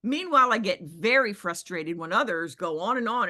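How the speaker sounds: ordinary delivery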